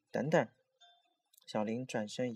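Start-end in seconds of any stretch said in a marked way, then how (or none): 0.1-0.5 sudden louder call; quick raised-voice halt; calling out to stop someone. A narrator's reading. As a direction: call after a friend ahead, urgently. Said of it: "他突然叫住前面的小林".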